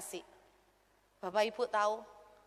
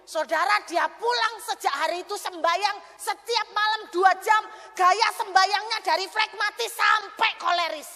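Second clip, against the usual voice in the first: loud, high preacher's voice; fiery preaching; 8 semitones higher, 8 dB louder than usual. Acted. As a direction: preach it at full force. Preaching loudly to congregation.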